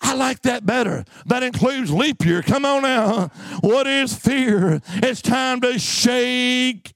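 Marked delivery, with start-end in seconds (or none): none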